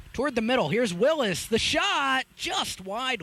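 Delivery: high, loud play-by-play burst; excited shot call. Real, not acted. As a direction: build to a peak on the shot. Live call of a near miss.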